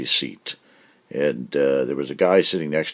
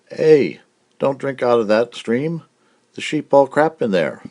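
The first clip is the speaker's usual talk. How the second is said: higher, louder yelled warning; yelling farmer; 9 semitones higher, 6 dB louder than usual. Acted. Welshman yells a warning at the stranger. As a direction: yell across the field at the stranger. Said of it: "The guy yells at him in Welsh".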